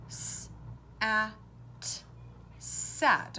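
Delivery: B, a, t separated, then blended into bat; sounding out. Shown as a demonstration. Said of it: "How about sat?".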